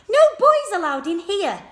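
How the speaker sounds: high, loud indignant yell; outraged shout. A narrator's reading. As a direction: chase the boy out. Girls outraged at boy.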